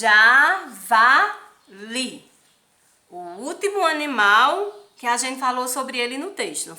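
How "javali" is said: JAVALI read in slow, loud, separated syllables. Shown as a demonstration. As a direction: break "Javali" into JA, VA, LI, loud and stretched. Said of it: "pra gente ler". ("Javali" lido alto, sílaba por sílaba)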